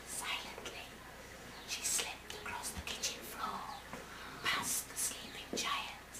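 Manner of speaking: hushed slow whisper; whispered narration. A narrator's reading. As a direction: tiptoe the words past a sleeper. Sneaking past sleeping giant.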